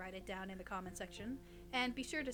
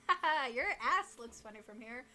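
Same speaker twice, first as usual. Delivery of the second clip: mocking laugh; 6 dB louder than usual. Acting the ghost's mocking laugh.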